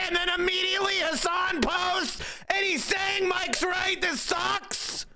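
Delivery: high, shouting, vindicated; crowing that he was right all along; triumphant yelling. Real, not acted. Streamer yelling at chat that he was right, excited high shout.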